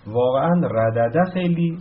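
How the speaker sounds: ordinary talk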